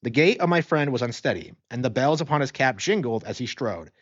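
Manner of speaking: plain reading voice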